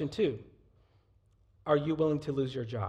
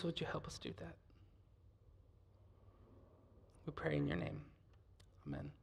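prayer; 3 semitones higher than usual. Acted. Leads closing prayer.

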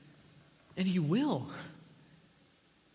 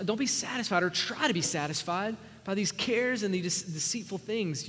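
normal talking voice

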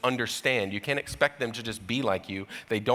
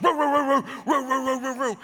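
high roo roo barking; mock dog barking, high and insistent; imitated dog barking; 4 dB louder than usual. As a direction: bark over and over like the dog. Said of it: "he just keeps barking".

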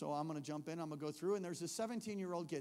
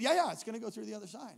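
quick, loud, raised insistence; hurried reassurance; 5 semitones higher, 7 dB louder, faster than usual. Retold or imitated. Answering the nervous kid, hurriedly reassuring. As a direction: brush off the worry fast.

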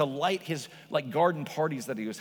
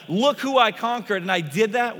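pitched up, louder, victorious call; triumphant boast; 7 semitones higher, 10 dB louder than usual. Retold or imitated. Jesus leading the parade, crowing in triumph. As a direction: gloat over the conquered foe. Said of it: "Jesus is leading the parade and is like".

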